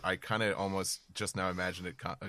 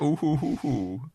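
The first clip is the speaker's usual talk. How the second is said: mock villain laugh; 8 semitones higher, 4 dB louder, slower than usual. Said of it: "He's like".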